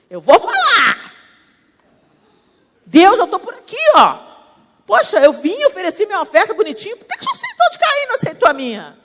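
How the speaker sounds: hammed-up petulant grievance; sulking Cain.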